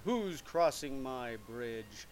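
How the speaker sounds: troll leaps up at the biggest goat, slow menace. Acted. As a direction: bellow the threat slowly like a troll. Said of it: "The same troll jumped up and said".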